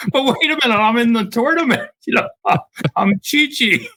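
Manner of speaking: high, laughing mock protest; laughing impression. Golfer mimics Chi-Chi barred at the gate, laughing and high. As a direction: squeal it through laughter. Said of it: "he's like".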